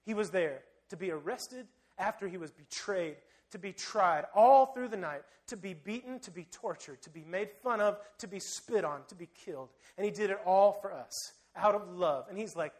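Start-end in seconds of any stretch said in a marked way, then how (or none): none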